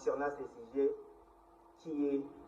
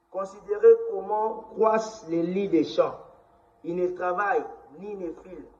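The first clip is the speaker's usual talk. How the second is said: solemn public proclamation, higher and louder; scripture reading; 5 semitones higher, 7 dB louder than usual. Acted. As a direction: proclaim the verses loudly, phrase by phrase. Continues reading Bible verses aloud.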